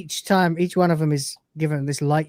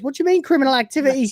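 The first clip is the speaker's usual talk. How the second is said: freaking out; 10 semitones higher, much faster than usual. Retold or imitated. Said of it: "Lister freaks out".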